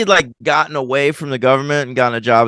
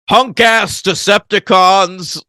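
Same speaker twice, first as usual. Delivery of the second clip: drawn-out, higher-pitched imitation war cry; mock battle cry; 6 semitones higher, slower than usual. Podcaster quoting favorite movie line from a fight, mock battle cry. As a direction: call it out slowly like a robot taunting foes.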